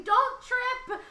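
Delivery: very high, full-throated warning shout; screaming. Acted. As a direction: yell it across the dock. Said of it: "and I'm screaming".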